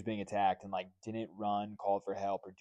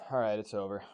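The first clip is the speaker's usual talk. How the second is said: normal talking voice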